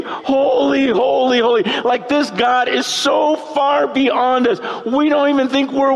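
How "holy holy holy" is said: high, intense cry of awe; mock screaming. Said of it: "they're screaming".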